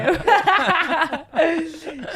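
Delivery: bursts out laughing; a real, delighted laugh. Real, not acted. Laughs at own impression.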